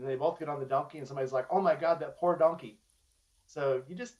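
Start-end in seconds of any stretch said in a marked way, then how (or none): 1.5-2.8 louder, higher exclamation of dismay; mock outraged pity. Onlooker in a fable seeing the overloaded donkey, mock outraged pity. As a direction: play a scandalized passer-by.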